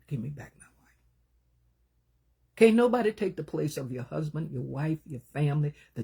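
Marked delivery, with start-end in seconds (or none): 0.1-1.0 whispered plea. Retold or imitated. Retold plea of grieving husband.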